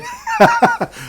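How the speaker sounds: sudden high squeal of a laugh; loud, high laugh at a friend's joke; high burst of laughter. Real, not acted.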